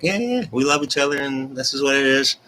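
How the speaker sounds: high, small imitated couple voice; sheepish couple. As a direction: confirm it in a put-on high voice. Couple answering their squealing friends.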